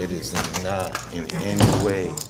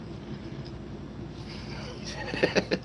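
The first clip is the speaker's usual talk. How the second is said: long, soft wheeze of laughter; 5 semitones lower, 8 dB quieter than usual. Real, not acted. Streamer amused by chat's pause, soft laugh. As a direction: laugh quietly, mostly on breath.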